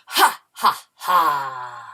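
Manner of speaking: loud, spooky "ha, ha, haaa" falling at the end; ghostly laugh. Shown as a demonstration. Class repeating after three, ghostly laugh. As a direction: boom out a ghost's slow laugh. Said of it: "A very ghostly laugh".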